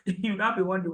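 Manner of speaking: ordinary talk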